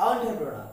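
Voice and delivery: entrepreneur as on-tro-pro-na; correct pronunciation. Shown as a demonstration. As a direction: say entrepreneur with an ON start. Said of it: "আরেকবার খেয়াল করার চেষ্টা করি".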